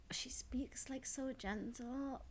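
slow, very soft gentle voice, almost all whisper. Retold or imitated. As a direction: imitate her with a soft, breathy whisper. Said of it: "That she doesn't speak like that".

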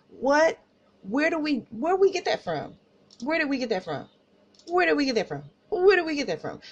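high-pitched, repeated questions in disbelief; incredulous. Real, not acted. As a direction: keep asking, baffled and high in pitch.

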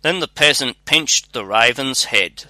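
ordinary delivery

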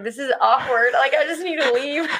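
quoting herself through embarrassed laughter; giggly mock embarrassment; laughing awkwardly. Retold awkward moment in the store.

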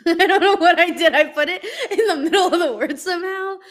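high, loud, laughing through the words; real laughter at your own slip. Real, not acted. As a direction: crack up while talking.

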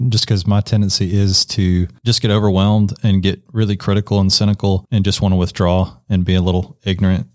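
normal talking voice

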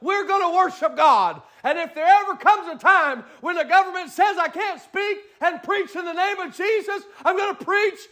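climbing, high, full-force preaching; rising preacher cadence. Acted. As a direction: build the sermon to a peak.

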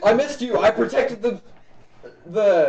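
high, loud excited knight; devoted servant shouting with joy; eager loyal knight. Acted. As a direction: report proudly to your master at full volume. Knight greets master excitedly.

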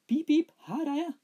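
very high, loud piping squeak; squeaky boy voice. A narrator's reading. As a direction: pipe it like a tiny boy. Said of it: "sa Smørbukk".

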